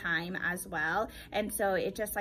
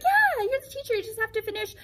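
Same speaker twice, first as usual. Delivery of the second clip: high, loud breezy principal; principal's breezy reply; 11 semitones higher, 4 dB louder than usual. Retold or imitated. Principal confirming the job, high breezy voice. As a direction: toss it off like a busy principal. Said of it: "she's like".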